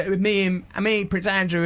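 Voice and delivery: ordinary talk, nothing marked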